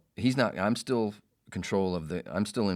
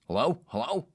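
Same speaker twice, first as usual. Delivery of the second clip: loud, higher, insistent hello; caller shouting hello; 10 semitones higher, 6 dB louder than usual. Retold or imitated. Man locked outside calls into the door speaker, shouting. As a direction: imitate someone calling impatiently over an intercom.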